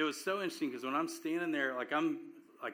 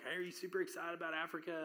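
higher, quick, eager friend's voice; lightly voiced friend; 5 semitones higher, 4 dB quieter, faster than usual. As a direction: toss it off like a chirpy buddy checking in. Friends asking before the trip.

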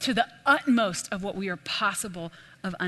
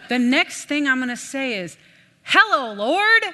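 high, much louder cheery greeting with a laugh; grandpa's cheerful voice; 5 semitones higher, 8 dB louder than usual. Retold or imitated. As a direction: hail the Lord like an old friend. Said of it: "the way he talked was so funny".

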